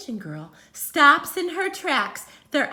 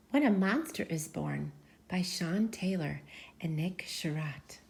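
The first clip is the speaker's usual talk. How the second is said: breathy hushed reading voice; hushed storyteller opening; whispered title; 7 semitones lower, 6 dB quieter than usual. Acted.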